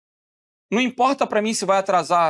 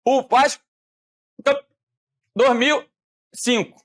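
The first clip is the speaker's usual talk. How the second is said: words cut off like a breaking call; choppy, syllables missing; 4 semitones higher than usual. Shown as a demonstration. Teacher imitating a voice call that loses syllables, choppy and broken. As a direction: drop syllables as if the connection fails. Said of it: "se eu falar assim".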